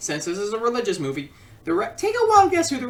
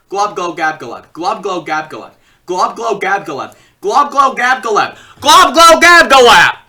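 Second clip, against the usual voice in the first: starting plainly, getting louder with each go until shouting; tongue twister building up; 11 dB louder than usual. Shown as a demonstration. Reviewer doing the tongue twister five times, building to a shout. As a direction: rattle it off faster and louder each time. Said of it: "Try saying that five times".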